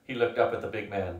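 ordinary delivery

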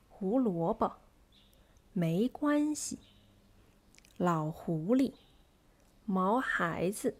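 strong middle syllable and light last, as in 胡萝卜; medium, stressed, light. Shown as a demonstration. Teacher reads examples, middle syllable stressed, last light. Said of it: "the second syllable is stressed".

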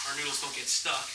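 ordinary delivery

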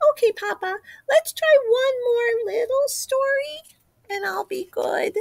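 put-on child voice. Chick pleading for one more.

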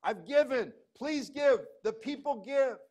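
high, loud, eager, slowed appeal; excited David. Retold or imitated. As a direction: announce the gift with bursting excitement. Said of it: "He, he's excited".

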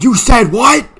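high, loud incredulous question; shocked disbelief. Real, not acted. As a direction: react in total disbelief. Reacting in game.

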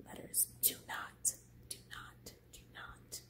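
hushed whispered warning; mock-secret whisper. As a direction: breathe it out, no voice.

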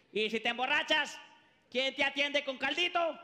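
usual speaking voice

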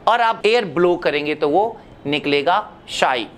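ordinary talk, nothing marked

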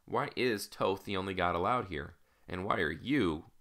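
ordinary delivery, nothing marked

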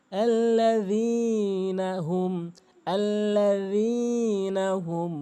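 আল্লাযীনা with tongue tip at the front teeth for the z; correct dhal recitation. Shown as a demonstration. Teacher shows the dhal to learners, slow Quranic chant. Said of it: "সামনে দুটি দাঁতের আগা মিলাবেন".

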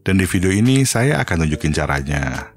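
usual speaking voice

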